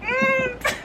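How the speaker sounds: a squeaky, swooning "mm"; mock swoon over cute scenes; delighted high hum. Reader swooning at smutty scenes, high delighted hum. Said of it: "You're just like".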